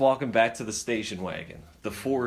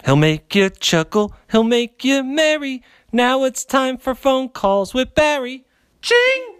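loud show host intro; big announcer welcome; radio announcer; 9 semitones higher, 9 dB louder than usual. Acted.

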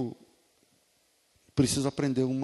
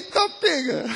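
amused and higher, laughing through it; laughing reply; 12 semitones higher, 5 dB louder, slower than usual. Retold or imitated. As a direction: shrug it off with a laugh. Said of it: "eu falei". (respondendo ao pastor, rindo, mais agudo)

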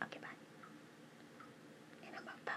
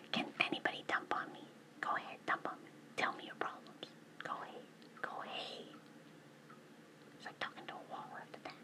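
whispering; faster than usual. Real, not acted. Whispers through most of her talk.

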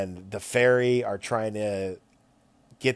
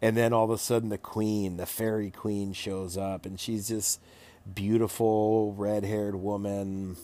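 usual speaking voice

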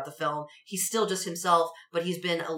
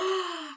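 gasp; 10 semitones higher, 4 dB quieter than usual. Retold or imitated. Alan Ruck appearing.

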